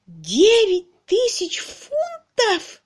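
slow, very high wail of disbelief; impossibly high. A narrator's reading. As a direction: wail each word at the top of your voice. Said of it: "достиг немыслимых высот".

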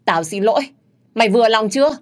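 loud, high defiant yell; shouted apology. A narrator's reading. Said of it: "Nó hét lên một tiếng".

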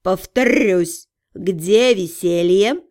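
slow, low, bored drawl; lazy bored demon cat, very slow; snooty bored cat. A narrator's reading.

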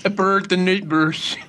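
slow, careful American accent; attempted American accent. Shown as a demonstration. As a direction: try an American accent, slowly. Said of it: "Is that the Swedish Chef version?".

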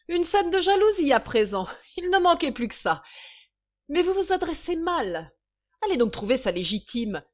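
high, loud mocking indignation; exasperated scolding. Mistress dismissing a jealous visitor, exasperated. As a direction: brush her off at full voice.

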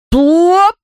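high, loud rising plop; popping sound. A narrator's reading. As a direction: pop it out as something suddenly shrinking. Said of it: "Такой звук издала П К П". (высокий громкий хлопок «плоп»)

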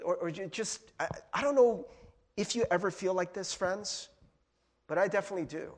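ordinary talk, nothing marked

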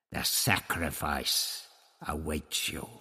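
a hint of a snarling priest; snarling Tlatoxel. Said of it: "he snarled".

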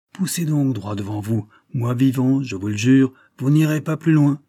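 firm, building defiant challenge; solemn oath building to open defiance; defiant vow. A narrator's reading. Duke bars the way, swearing.

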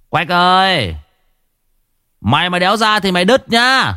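a menacing call through the door; changed voice. Acted. Narrator changes voice to threaten Quách, menacing call. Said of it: "tôi liền đổi giọng".

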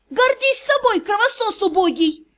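high, scornful yell; yelled insult. A narrator's reading. Vampire girl insulting the clan head, yelled for the house. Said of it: "На весь дом разнеслось".

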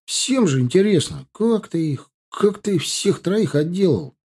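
excited. Acted. Солдаты расспрашивают рассказчика.

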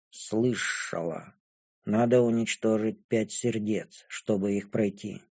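low, hushed, detached voice; impassive dragon girl. A narrator's reading. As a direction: answer quietly and without emotion.

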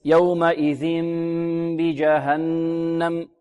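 "يومئذ بجهنم" slowly intoned, long hummed m before the b; iqlab without pressing lips. Shown as a demonstration. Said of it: "ببعضهما بدون كز". (إقلاب بغنة طويلة دون كز الشفتين في "يومئذ بجهنم")

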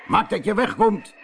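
angry shout. A narrator's reading. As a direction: chase the beggar off. (boos geschreeuwd)

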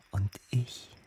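slow, hushed menace; whispered threat. Acted. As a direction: whisper it slowly as a secret vow. Innerer Monolog über den geplanten Verrat. (langsam geflüsterte Drohung)

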